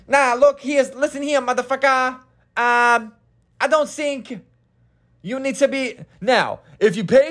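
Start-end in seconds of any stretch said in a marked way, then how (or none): 0.1-6.0 high-pitched put-on voice of an actor going off-script; Jim Carrey impression. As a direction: play a hyped-up comic improvising a tough guy, pitched way up. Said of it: "Here's Jim Carrey improvising some scenes".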